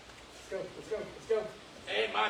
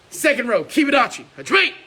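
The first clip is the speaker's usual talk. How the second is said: loud, higher calls to the row; shouted commands; 4 semitones higher, 11 dB louder than usual. Real, not acted. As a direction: bark stance then start. Coach commands second row.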